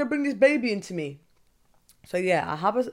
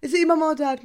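high, loud curious question with a laugh; prying coworker; 7 semitones higher, 6 dB louder than usual. Retold or imitated. Coworker pressing about which parent, high laughing question. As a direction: chuckle through the nosy follow-up. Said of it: "And it's like".

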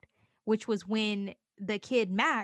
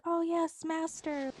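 a hint of a fawning witch, higher pitched; 8 semitones higher, slower than usual. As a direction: lightly play the witch bowing to her master. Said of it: "they're like".

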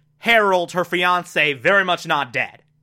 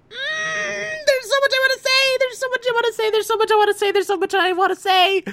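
excited squeal; faster than usual. Real, not acted. Fan overwhelmed by the episode.

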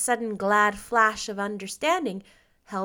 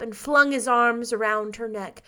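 plain reading voice

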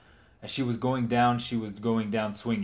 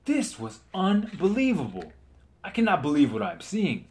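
amazed narration; 7 semitones higher, 4 dB louder than usual. Acted. Narrator reacts in awe.

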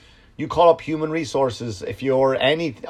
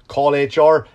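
ordinary delivery

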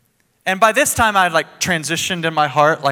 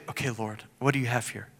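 hushed, softer prayer to the Lord; quiet prayer; 4 semitones lower, 10 dB quieter than usual. Shown as a demonstration. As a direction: say it softly like a calm prayer. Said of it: "it was more like this".

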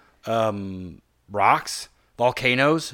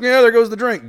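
cheery toast; 11 semitones higher, 11 dB louder than usual. Retold or imitated. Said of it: "so I was like".

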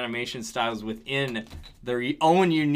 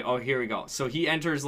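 normal talking voice

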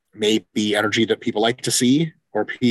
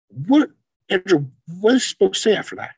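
lightly voiced confused heckler; mock bewildered troll; 6 semitones higher than usual.

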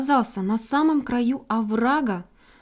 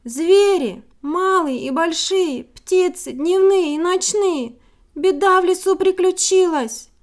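high, loud call for help; 6 semitones higher, 5 dB louder than usual. A narrator's reading. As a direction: call through the whole forest. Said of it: "во всю мощь всех созывает".

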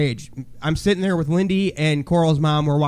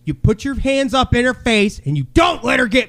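building from firm talk to a raised, high shout; retold coaching yell; 4 semitones higher than usual. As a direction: drill it into her, voice climbing. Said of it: "And I, like, yelled at".

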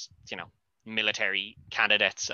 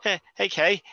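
higher-pitched, nervous greeting; acted nervousness; 6 semitones higher, slower than usual. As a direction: play a man caught out and scared. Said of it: "the fear on, uh, on his face".

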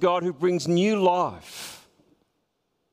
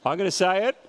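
usual speaking voice

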